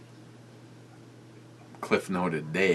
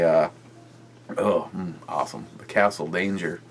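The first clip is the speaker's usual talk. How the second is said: ordinary delivery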